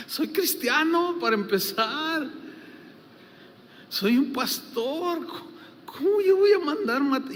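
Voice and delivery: mild mock outrage; indignant. Pastor protests to the church member, retold indignation.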